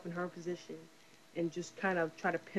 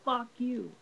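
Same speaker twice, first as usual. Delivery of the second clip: higher, defiant "Fuck you" thrown at the world; a frustrated teenage girl telling the whole world "Fuck you", pitched up and defiant; teen defiance; 6 semitones higher than usual. Retold or imitated. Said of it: "what teenagers wanna say, like, to the whole fucking world".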